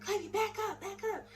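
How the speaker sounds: very high, squeaky imitation of panic; re-enacted high-pitched pleading; retold frantic plea. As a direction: urge him away in a tiny high voice. Relays plea to husband in hysterics.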